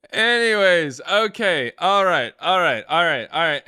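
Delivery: loud, high, repeated resets; pulling himself together. Real, not acted. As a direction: rein in the laughter with forceful words. Pulling self together after laughing.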